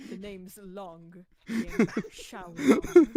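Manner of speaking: slow British spy introduction; British Bond intro. Pretend. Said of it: "I don't know why I went British".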